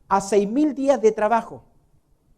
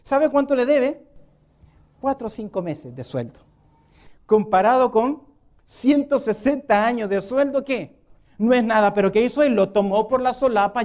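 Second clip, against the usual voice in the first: ordinary talk, nothing marked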